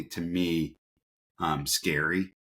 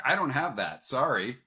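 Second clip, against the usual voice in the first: high, sheepish mock confession; lightly mocking interviewee voice; mock candidate reply; 8 semitones higher than usual. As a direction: play a candidate cheerfully admitting no integrity.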